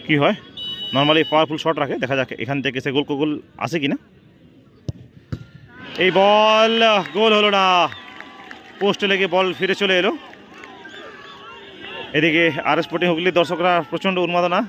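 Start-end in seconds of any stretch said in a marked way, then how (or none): 6.0-10.1 excited near-miss call. Real, not acted. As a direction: ride the shot then sag as it hits the post. Shot hits the post.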